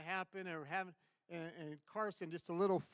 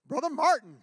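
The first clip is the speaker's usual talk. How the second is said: loud, higher call across the room; 9 semitones higher, 17 dB louder than usual. Real, not acted. Pastor calling out across the hall to the preacher, loud and high. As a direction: call his name out over the crowd.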